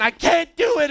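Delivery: put-on frustrated boy yelling back at his piano-teaching mom; retold yelling back. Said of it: "and I'm yelling back".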